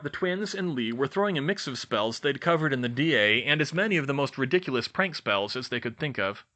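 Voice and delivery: ordinary delivery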